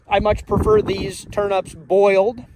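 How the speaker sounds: usual speaking voice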